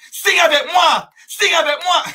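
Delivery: hammed-up high-pitched cry; mock-frantic plea at the top of the range; desperate begging. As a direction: beg them to sign, voice leaping way up. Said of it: "vous êtes désespéré par texte".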